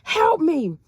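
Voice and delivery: high, loud yell for help; retold scream for help. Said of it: "And I'm calling my mama".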